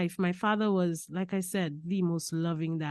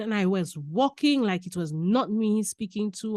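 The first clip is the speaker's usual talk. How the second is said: usual speaking voice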